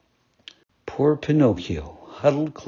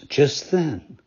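usual speaking voice

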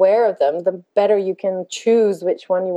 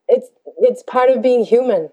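normal talking voice

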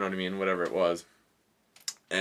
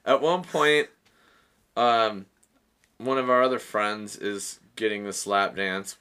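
ordinary talk

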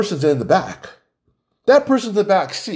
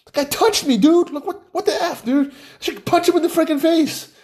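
much higher, laughing mock outrage; riled-up dude; 10 semitones higher than usual. Host showing how a guy would really text, laughing mock fury. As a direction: play an indignant bro, half laughing. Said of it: "you say that, "That".

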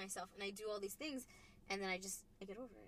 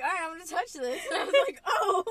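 laughing; 6 semitones higher, 10 dB louder than usual. Real, not acted. Retelling while laughing.

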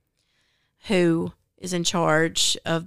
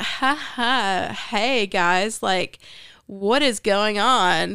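put-on jovial voice, lilting and stretched out; actress's character voice; 3 semitones higher, 4 dB louder, much slower than usual. Retelling the book to a friend, imitating the audiobook actress's fake-jovial voice. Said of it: "That's the voice the actress chose to use".

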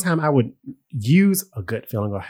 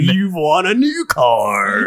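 game show host voice; 6 semitones higher, 3 dB louder, slower than usual. Pretend. Radio prize announcement.

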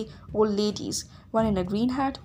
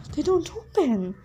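mild moan, pitched up; 6 semitones higher than usual. A narrator's reading. Grover finds the bus windows won't open, moaning. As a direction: moan it higher than your usual voice. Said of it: "Grover moaned".

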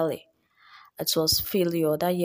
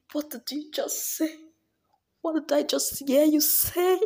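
high, tearful outrage, louder at first; indignant sob at being brushed off; Precious, outraged and crying; 12 semitones higher, 3 dB louder than usual. Acted. Outraged at her friend for calling her failure nothing, tearful high voice.

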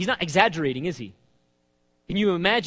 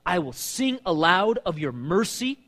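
slow, raised praise; proclaimed reading; 3 semitones higher, 4 dB louder, slower than usual. Acted. Preacher reading the praise line, slow and raised. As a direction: proclaim it like a vow.

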